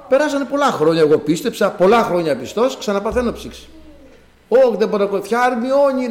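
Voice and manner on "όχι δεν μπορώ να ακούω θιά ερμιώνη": raised, high call to an aunt; re-enacted cry for help; retold calling out. Sufferer calls his aunt for her remedy, retold calling out. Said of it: "να φωνάζω".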